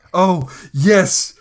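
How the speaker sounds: ordinary talk, nothing marked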